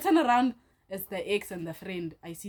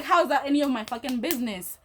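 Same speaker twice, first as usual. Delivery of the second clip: raised, louder indignant comeback; angry retort; 5 semitones higher, 6 dB louder than usual. Retold or imitated. Her answering the ex in the quarrel, angry and louder. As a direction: fire back in retold indignation. She snaps back in retold quarrel.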